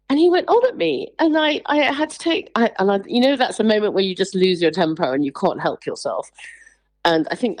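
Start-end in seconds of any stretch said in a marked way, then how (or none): none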